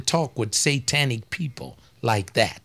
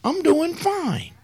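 high mock voices; 9 semitones higher, slower than usual. Imagined conversation, mock voices.

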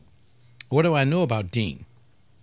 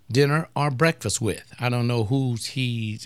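ordinary delivery

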